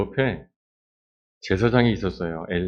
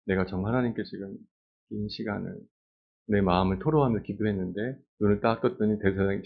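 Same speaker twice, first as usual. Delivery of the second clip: usual speaking voice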